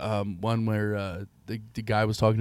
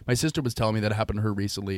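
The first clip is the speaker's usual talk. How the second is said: ordinary talk, nothing marked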